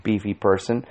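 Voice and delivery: plain reading voice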